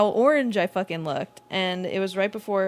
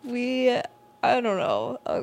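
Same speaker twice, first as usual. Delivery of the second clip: usual speaking voice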